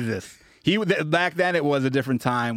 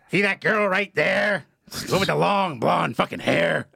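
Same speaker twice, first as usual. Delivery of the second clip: drawn-out, higher-pitched comic impression; imitating the comic; 4 semitones higher than usual. Comic pointing out the waitress from the stage, mocking impression. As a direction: put on the comic's voice, slow and pitched up. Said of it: "He's like".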